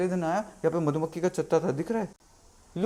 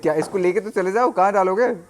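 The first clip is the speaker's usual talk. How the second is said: loud incredulous question; 4 semitones higher, 8 dB louder than usual. Retold or imitated. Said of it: "he's like".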